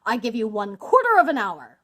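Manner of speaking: loud, hard-hearted villain; booming grudging concession; stern ultimatum. A narrator's reading. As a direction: lay down the limit forcefully. Bluebeard grants brief delay.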